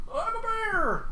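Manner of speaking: squeaky high bear character; a silly high bear announces itself. Pretend. Streamer voicing his bear, squeaky and high.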